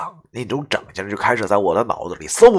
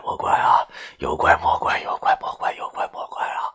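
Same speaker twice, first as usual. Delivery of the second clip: breathless frightened whisper to the spirits; trembling hushed prayer; scared whisper; 10 semitones lower, 6 dB quieter than usual. Acted. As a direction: whisper the plea as if terrified. Frightened prayer while peeing in the dark.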